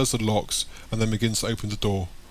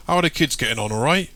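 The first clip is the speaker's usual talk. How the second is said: hearty market trader; 5 semitones higher, 4 dB louder than usual. Acted. Market man voiced in story.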